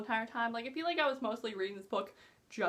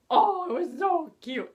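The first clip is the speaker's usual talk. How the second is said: gushing; 5 semitones higher, 8 dB louder than usual. Real, not acted. Gushing about the kiss.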